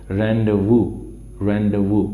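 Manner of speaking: rendezvous said carefully as ron-day-voo; pronunciation demo. Shown as a demonstration. Teacher modelling rendezvous for the class, careful and clear. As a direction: model the word clearly, z silent. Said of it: "इसकी pronunciation है rendezvous".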